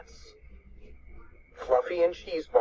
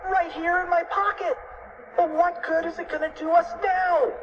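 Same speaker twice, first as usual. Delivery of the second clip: high-pitched cartoon panic; screamed in panic. A narrator's reading. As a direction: cry it high, at the end of your rope. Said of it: "screamed Cheeseball".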